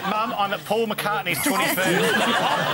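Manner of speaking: laughing mock telling-off from mum; mock mum on the phone. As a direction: play the scandalised mum down the line.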